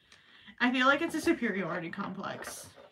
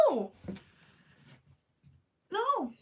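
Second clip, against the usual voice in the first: ordinary delivery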